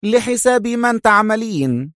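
slow, high, cold interrogation; harsh strictness. Acted. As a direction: demand an answer severely. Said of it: "في صرامة قاسية".

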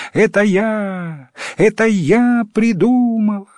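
high, stretched-out boastful cry; yelling at full throat. A narrator's reading. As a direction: call it out high and proud. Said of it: "закричала во всё горло".

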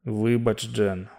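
slipping toward unconsciousness; weak farewell to Jan; fading away. Acted. Fading thought toward fainting.

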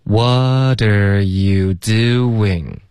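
every syllable stretched very long; super-slow pronunciation drill; extremely slow demo. Shown as a demonstration. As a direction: draw each word right out for learners to copy. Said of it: "我们再说几遍".

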